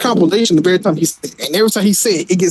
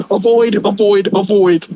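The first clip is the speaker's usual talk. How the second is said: imitating promo; slower than usual. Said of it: "the famous promo where he was like".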